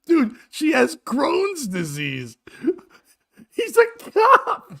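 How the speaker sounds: high, slow, incredulous exclamation; loud and high through real laughter; laughing disbelief. Real, not acted. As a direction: squeal it out, cracking up. Cracking up over the cop's texts.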